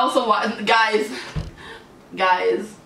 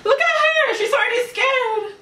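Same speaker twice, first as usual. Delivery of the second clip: much higher, startled pointing-out; alarmed higher voice at the screen; startled exclaim; 12 semitones higher than usual. Real, not acted. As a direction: gasp it out as she jumps. Screaming scene.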